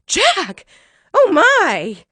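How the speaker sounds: high, loud astonished cry; startled mother calling out; mother's shout. A narrator's reading. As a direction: shout for the boy in amazement. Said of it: "made his mother shout".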